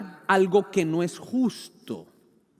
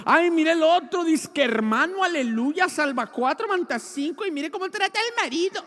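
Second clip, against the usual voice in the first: a mocking, higher voice for the gossiping churchgoer; 12 semitones higher, 6 dB louder than usual. Preacher mocking a gossiping churchgoer, in a higher, sneering voice.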